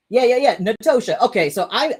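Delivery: ordinary talk, nothing marked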